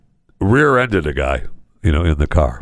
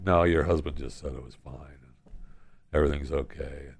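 low, hushed reassurance; placating; 8 semitones lower, 11 dB quieter than usual. Retold or imitated. Driver soothes the other man's wife, quiet placating. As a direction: talk her down very softly.

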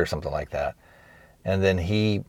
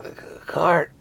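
struggling to speak; 8 semitones higher, 4 dB quieter than usual. Retold or imitated. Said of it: "he's having a lot of trouble speaking".

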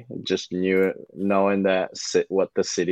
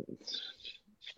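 whisper; 22 dB quieter than usual. Real, not acted. Joking aside about Laura.

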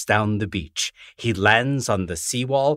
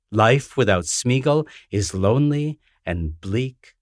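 plain reading voice

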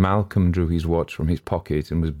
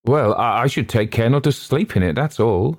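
short reply at a raised pitch; a touch curt, higher and louder; 6 semitones higher, 4 dB louder than usual. A narrator's reading. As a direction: answer shortly, voice lifted. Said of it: "said the voice shortly".

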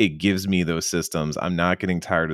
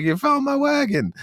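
squeaky, far higher, delighted cry; overjoyed NPC. Retold or imitated. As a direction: gush like a cartoon villager. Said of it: "and he's like".

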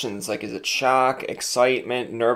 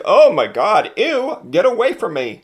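disgusted; 10 semitones higher, 6 dB louder than usual. Retold or imitated. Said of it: "They're more or less like".

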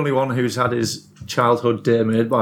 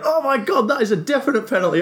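excited quote; 12 semitones higher than usual. Retold or imitated. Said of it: "I'm not gonna do the accent".

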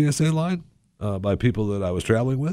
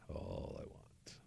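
unvoiced, slow whispered trail-off; 29 dB quieter, slower than usual. Shown as a demonstration. To the audience, a slow whispered trail-off. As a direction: whisper it to yourself. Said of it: "trailing whispered repeat".

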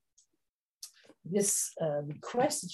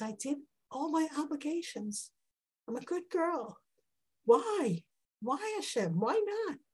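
high, pleading prayer voice; higher, softer pleading voice; Hannah pleading; 7 semitones higher, 3 dB quieter than usual. Acted. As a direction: plead like a desperate woman to God.

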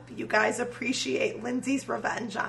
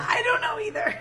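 laughing with a cracking voice; 12 semitones higher, 3 dB louder than usual. Real, not acted. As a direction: voice cracks high mid-laugh. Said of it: "I don't know what just happened to your voice".

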